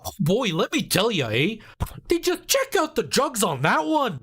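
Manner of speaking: high-pitched, hammed-up Canadian island accent. Shown as a demonstration. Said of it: "the stereotypical Canadian accent".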